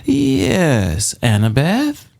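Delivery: put-on high, purring old woman voice; purring Auntie Em. A narrator's reading. Old woman purring sweetly to Annabeth. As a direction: purr it sweetly like a sly old lady. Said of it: "the woman purred".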